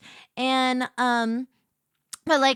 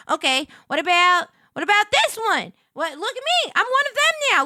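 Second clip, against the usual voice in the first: higher, louder, eager; bubbly look-at-me; excited show-off; 8 semitones higher, 7 dB louder than usual. Retold or imitated. Showing her model to family.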